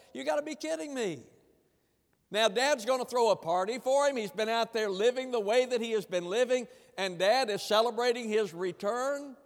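jealous brother. Retold or imitated. Voicing the jealous elder brother.

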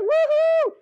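very high, loud whoop; excited cheer. Retold or imitated. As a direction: cheer wildly over one apple. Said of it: "You get all excited about one apple".

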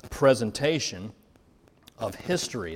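ordinary delivery

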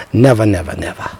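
fading never. Acted. Stern warning fading out.

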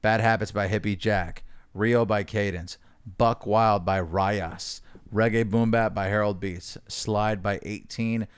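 quiet, low announcer read; credits read. Acted. Reading song credits.

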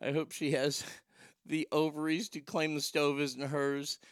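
ordinary talk, nothing marked

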